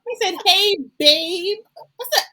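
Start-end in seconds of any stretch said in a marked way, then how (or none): 0.5-1.6 "babe" hit hard on both B's, high and loud; imitated B emphasis. Quoting Vince calling his girl, "babe" with popped B's. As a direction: imitate him punching the B's in "babe", pitched up. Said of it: "He put the emphasis on them B's".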